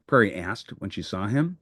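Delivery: plain reading voice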